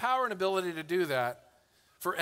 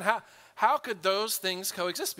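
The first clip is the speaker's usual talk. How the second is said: usual speaking voice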